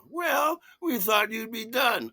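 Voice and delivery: the sister excusing herself to her brother, high and sheepish; sister's excuse. Retold or imitated. Sister answering back.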